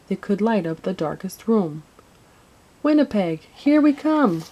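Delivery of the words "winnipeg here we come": high, loud gleeful cheer; storybook children's rallying cheer. A narrator's reading. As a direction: cheer the arrival like excited children. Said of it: "the children cheered".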